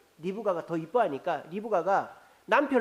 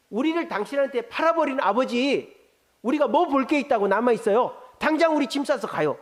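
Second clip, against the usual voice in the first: raised, higher, louder, pressing the husband to pack up; indignant wives' outcry; 6 semitones higher, 5 dB louder than usual. Acted. Rachel and Leah cheering Jacob on, louder and higher indignant. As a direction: belt it out like a fed-up wife.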